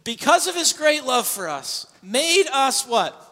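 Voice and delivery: loud, high impassioned preaching; full-force preaching. Acted. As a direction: drive the message home with fire.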